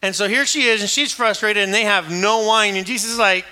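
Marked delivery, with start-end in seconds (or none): none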